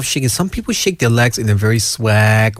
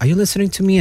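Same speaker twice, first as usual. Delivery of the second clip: higher-pitched mild annoyed challenge; mock annoyed; 9 semitones higher, much faster than usual. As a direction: imitate someone put out, pitch raised. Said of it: "And they get annoyed".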